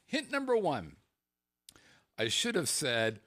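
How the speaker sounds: ordinary delivery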